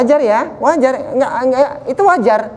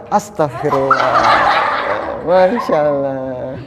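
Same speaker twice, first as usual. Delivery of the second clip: calm pious reply; 7 semitones lower, much slower than usual. Shown as a demonstration. Teacher shows calm reply to insult.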